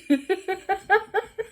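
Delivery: high, loud giggling laugh. Real, not acted. Laughs at own story.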